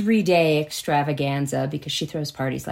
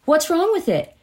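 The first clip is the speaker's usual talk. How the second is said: high, pushy repeated demand; imitating a nagging husband; insistent hammering; 12 semitones higher than usual. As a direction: keep pressing at a higher pitch. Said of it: "he just kept hammering at".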